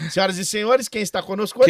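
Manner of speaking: high, loud showman announcement; grand entrance announcement. Acted. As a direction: announce the guest like a game show host. Host announces the guest. (anúncio de grande entrada)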